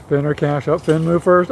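normal talking voice

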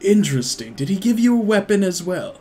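lightly voiced prying stranger, pitch lifting on the question; intrigued stranger. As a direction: inquire with sly interest. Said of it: "He pats you on the sides".

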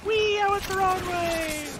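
high, giddy squeal; gleeful squeal. Real, not acted. Gliding, squeals with glee.

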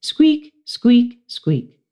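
high squeaky squeak; hamster squeak. Acted. Classmates hearing only the hamster squeak. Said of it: "all they heard was".

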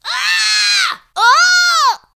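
two piercing high screams, loud and far off; boys' terrified screams. Acted. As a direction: scream in terror from your bed. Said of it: "woke up with a scream and a shout".

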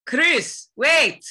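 put-on high, urgent African-accented call; insistent cry to wait. Calls out in character.

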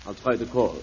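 ordinary talk, nothing marked

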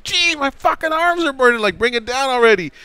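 high, strained whiny plea; mock a guy whose arms give out; strained complaint. Buddy hanging from the forks begging to come down, high strained whine.

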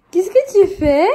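playful ask. Real, not acted. Mother catches her son at mischief, loud playful question. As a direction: ask him out loud what he's up to.

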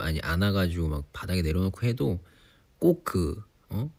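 usual speaking voice

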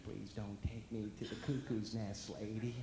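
a lightly voiced slow Jack Nicholson plea; patient begging the nurse, hint of Nicholson. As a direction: drag it out like Nicholson pleading. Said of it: "like Jack Nicholson".

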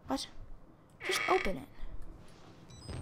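hushed scared whisper. Real, not acted. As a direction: whisper it, afraid to be heard. Whispering during scary game.

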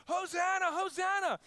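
high, loud ringing hails; crowd cheer. Retold or imitated. Crowd hailing Jesus, loud high cheer. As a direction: cry it out like a festival crowd. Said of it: "every person that was saying".

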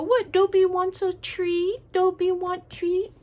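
high, slow cutesy voice for the dog; mock Dobby-speak, high and slow; Dobby baby talk. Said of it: "And so we go".

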